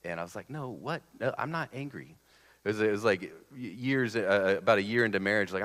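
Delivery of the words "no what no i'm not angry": higher, softer protest of disbelief; retold baffled denial, pitched up; mock-innocent denial. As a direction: play at being baffled by the accusation. Retold denial of being angry.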